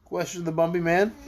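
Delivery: plain reading voice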